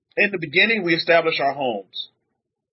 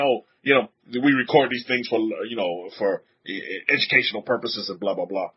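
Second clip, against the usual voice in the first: lower, mumbled mock disclaimer, fading out; recorded call notice; 9 semitones lower, 4 dB quieter than usual. Preacher mimicking the bank's recording notice, low and fading. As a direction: rattle off the boring recorded notice. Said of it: "they tell you in the beginning".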